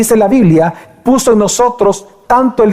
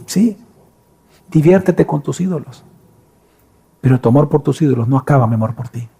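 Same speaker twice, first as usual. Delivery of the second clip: normal talking voice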